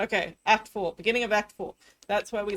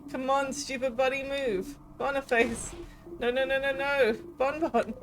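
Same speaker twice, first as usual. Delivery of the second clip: higher, pleading cries; frantic pleading; 5 semitones higher than usual. Acted. Friends pleading as the king crushes Bonnie, frantic. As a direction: beg desperately for the child.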